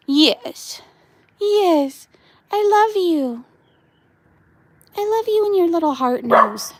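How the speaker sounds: high, cooing pet voice. Real, not acted. Owner coos love at her little dog in a high sing-song. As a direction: coo up high at the puppy.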